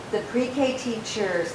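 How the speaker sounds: ordinary talk, nothing marked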